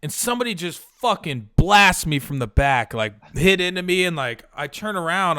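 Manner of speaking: normal talking voice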